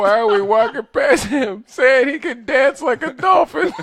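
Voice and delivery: high, loud laughing disbelief; laughing shout. Real, not acted. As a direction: yell it through laughter.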